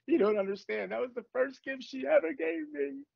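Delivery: sending up his own fuss over cheap glasses; mock whiny self. Mocking himself to his friend in a high, pleading whine.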